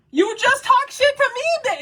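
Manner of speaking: high, mock-snotty comeback; sassy imitation of yourself talking back; mocking retort. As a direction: throw it back at her in a bratty high voice.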